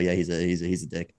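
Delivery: ordinary delivery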